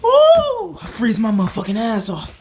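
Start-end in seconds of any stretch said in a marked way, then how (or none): 0.0-0.8 high loud laughing yelp. Real, not acted. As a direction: yelp from the freezing cold. Yelp at the cold.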